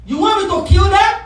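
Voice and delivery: high, loud challenge with rising end; exasperated question. Acted. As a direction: demand it loudly, voicing an exasperated God. Voices God's exasperated question.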